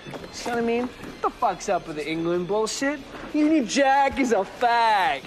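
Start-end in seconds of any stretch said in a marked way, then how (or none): 3.3-5.2 slow, drawn-out loud taunt; loud mocking heckle; loud jeer. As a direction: jeer it out, stretching the words. Mocking rant at English pretensions.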